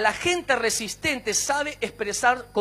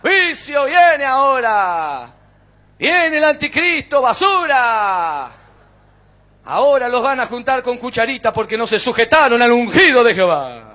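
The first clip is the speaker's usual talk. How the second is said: loud, drawn-out hellfire shouts; hammed-up doom preacher yelling insults; shouting judgment; 8 dB louder than usual. What Paul could have yelled at the sailors, mock judgmental shouting. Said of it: "Pablo podía haber entrado a los gritos".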